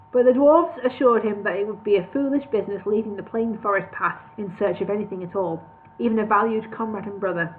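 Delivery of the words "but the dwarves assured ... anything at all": usual speaking voice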